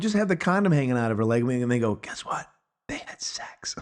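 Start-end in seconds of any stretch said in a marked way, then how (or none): 2.0-3.7 hushed mock revelation, whispered; conspiratorial whisper. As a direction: whisper it like an obvious secret. Filmmakers letting viewer in on secret.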